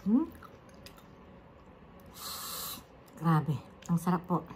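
chewing while talking; speaking around food; mouth full. Real, not acted. Seen 1: Bites crackling at 1.5, jaw and lips keep chewing throughout.

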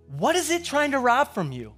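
ordinary talk, nothing marked

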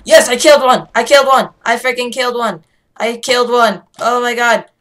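loud, high, elated yelling; triumphant shout. Real, not acted. Player celebrating a kill, triumphant shout.

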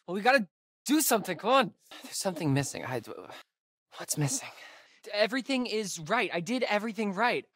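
high, strained and anxious; frantic. Acted. Fixing the device in a hurry, high frantic pleading. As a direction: push through the panic as it fails.

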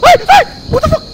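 shrieking very high and loud; terrified screaming. Real, not acted. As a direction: scream in real terror. Jumpscare in horror game.

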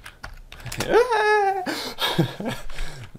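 long high-pitched burst of laughter. Real, not acted. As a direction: let a squealing laugh run out. Laughs after a play.

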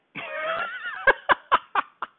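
long, loud, high-pitched laughter; hearty laugh. Real, not acted. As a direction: crack up at your own joke. Laughs at own imagined joke.

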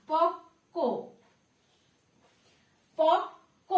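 পক্ক as pok, pause, ko; correct pronunciation. Shown as a demonstration. Teacher repeats the word while writing it, correct pronunciation. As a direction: split পক্ক on a doubled k, no b. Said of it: "পকব না".

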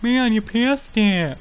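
high, loud mock scolding voice of the viewers; mocking chat viewers. Viewers scolding the streamer for passing it, high mock yell. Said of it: "Everyone's probably screaming at me".

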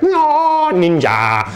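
drawn-out mock ninja war cry, starting very high; little guy's battle cry. Little dwarf guy backing up the mugger, mock battle cry. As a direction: imitate a tiny attacker's ninja yell. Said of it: "little dwarf guy's like".

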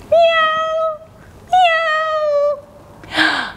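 high squeaky cat meows; kitty meows. Pretend. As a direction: meow like a happy kitten. Happy cat pose meows.

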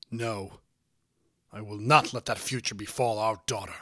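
firm, emphatic refusal; stern, resolute father; deeply serious. A narrator's reading. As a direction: lay down the law gravely. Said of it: "in a deeply serious voice".

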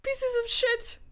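rising into a high, loud scream; panicked yelling. Real, not acted. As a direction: yell in alarm as pests attack. Panics at bug and crab.

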